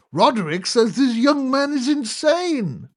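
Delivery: high, slow shouted repeat; a high roar to Aunt Jane. A narrator's reading. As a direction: roar it to a deaf old aunt. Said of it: "roared the prof".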